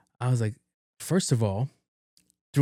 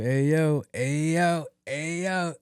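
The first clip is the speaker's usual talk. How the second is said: repeated hail climbing higher; teasing call-out; 5 semitones higher, much slower than usual. Retold or imitated. Teasing every dude walking by about single friend, pestering hail. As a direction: call to passers-by, pitch rising each time. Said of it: "I'm just being a dick".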